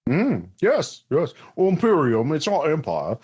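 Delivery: prim little clerk with a put-on accent; Hunby. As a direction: correct them as a fussy functionary. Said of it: "having a moment with this accent".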